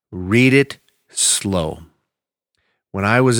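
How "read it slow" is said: "Read it slow" drawn out with long gaps; slow, deliberate repeat. Shown as a demonstration. Pastor showing the congregation how slowly to read. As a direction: model the slow reading.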